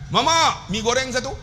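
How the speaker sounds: high, lightly voiced pupil; pupil asking. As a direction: pipe up like a kid at the canteen. Ustaz voices pupil asking canteen.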